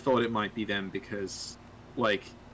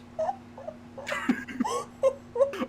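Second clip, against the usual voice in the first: burst of laughter. Real, not acted. Breaks up laughing mid-bit.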